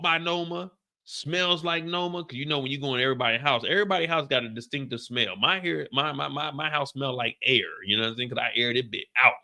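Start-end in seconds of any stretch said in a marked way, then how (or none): none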